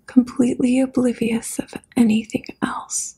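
usual speaking voice

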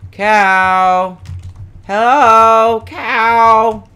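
loud, high, drawn-out call. Real, not acted. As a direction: holler for a missing cow. Calls for the missing cow.

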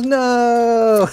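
loud, high mock wail; hammed-up high yelp; anguished no. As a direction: cry out in fake despair. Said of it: "He goes".

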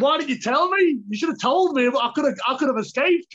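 high-pitched, exasperated complaint; retold indignant protest. Fighter reproaches his cornerman for not warning him, retold indignant protest. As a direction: reenact an aggrieved protest, pitched well up.

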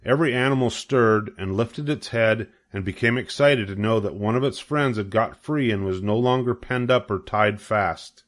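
plain reading voice

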